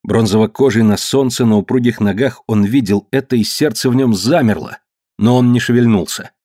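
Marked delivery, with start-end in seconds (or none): none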